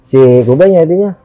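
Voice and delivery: ordinary delivery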